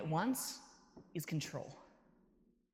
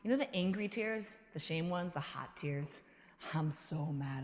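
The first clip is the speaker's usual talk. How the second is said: normal talking voice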